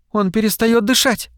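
high, urgent alarm without shouting. A narrator's reading. Tina warns the others about the alien, alarmed. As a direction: raise the pitch in sudden worry.

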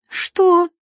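high, annoyed "what?"; displeased. Acted. Feride looks up from her magazine at Alex, displeased. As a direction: pitch it up in displeasure. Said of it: "недовольно поморщилась Фериде".

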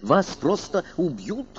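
berserk rage. Acted. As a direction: spit it out in a rage. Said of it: "в бешенстве закричал техник".

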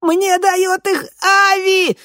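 very high frightened squeal; squeal, slow and panicked; rubbery high squeaky toy. A narrator's reading. Terrified victim squeals answer.

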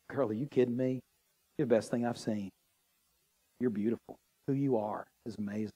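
soft, low and tender; tender reassurance. Acted. Boyfriend soothing the insecure woman, low and tender. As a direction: reassure her gently, like an adoring boyfriend.

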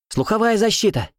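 high urgent call; urgent reminder. A narrator's reading. Luneya reminds teammates about ear protection, high. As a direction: remind everyone quickly. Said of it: "напомнила Люнея".

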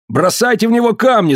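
very high-pitched urgent cry; shouted incitement. A narrator's reading. Tombol urges the group to stone Dikar, high cry. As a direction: rouse the crowd with a high call. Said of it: "закричал Томбол".

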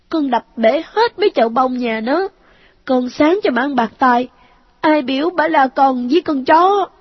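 put-on high child voice, smug; a boy proud of his revenge; gloating ghost child. A narrator's reading. Ghost son boasts to his mother, high smug child voice.

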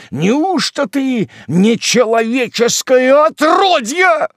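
high, loud, drawn-out raging; outraged bellowing tirade; furious outburst. Acted. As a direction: berate her, climbing to a roar. Hermit rages at weeping widow.

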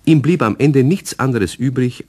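usual speaking voice

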